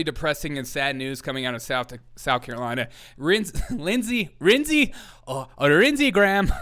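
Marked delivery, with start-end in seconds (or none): 3.2-6.5 the name breaks into laughter, pitch jumping high; giggling through Lindsey Graham, louder; laughing at the name. Real, not acted. Host fighting laughter while announcing Lindsey Graham.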